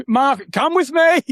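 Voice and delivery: mock umpire order. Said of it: "So he was going".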